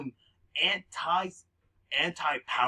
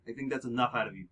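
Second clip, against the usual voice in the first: quick dismissive cutoff; 3 semitones lower, faster than usual. Acted. Tony cuts off a reporter.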